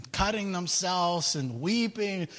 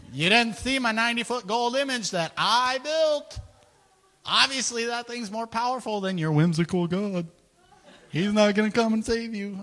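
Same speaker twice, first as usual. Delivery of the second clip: higher, louder gloating king voice, laughing at the end; boastful king; 6 semitones higher, 5 dB louder than usual. Retold or imitated. Nebuchadnezzar taunting the three men, higher gloating voice. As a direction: crow over them like a proud tyrant. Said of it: "So Nebuchadnezzar's like".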